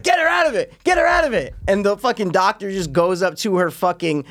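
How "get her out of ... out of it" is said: high, insistent mock alarm; hammed-up panic, repeating the order; urgent cops. Cops demanding she be brought out, high mock panic. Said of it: "they're like".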